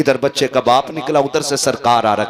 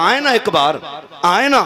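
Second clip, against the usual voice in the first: higher and louder, yearning; crying call; 10 semitones higher, 5 dB louder than usual. Retold or imitated. Dying boy calling for his beloved, high and loud. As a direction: cry out for him.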